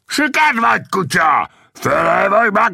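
high, furious bandit yell; enraged bully bellowing insults; foaming-mad shout. A narrator's reading. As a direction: scream abuse in a rage. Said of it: "kiáltotta a busa fejű, tajtékzó haraggal".

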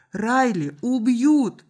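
put-on boy's urgent outcry; crying out. A narrator's reading. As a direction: cry out slowly like an alarmed boy. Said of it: "выкрикнул мальчик".

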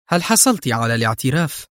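ordinary delivery, nothing marked

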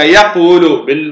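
ordinary delivery

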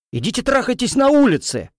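high angry yell; growling. Acted. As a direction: roar it at them. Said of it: "рычу я".

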